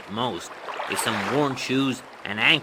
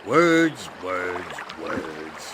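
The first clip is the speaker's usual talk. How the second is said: scornful, fading away; 4 semitones lower, much slower than usual. Acted. Ferryman dismisses the boasting hero, scorn fading to a murmur. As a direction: let the scorn die away word by word.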